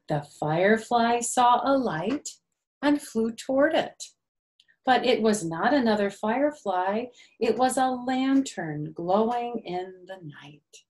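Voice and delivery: gentle, sing-song storybook reading; lightly lilting picture-book narration; reading to children. A narrator's reading. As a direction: read it like circle time for little ones.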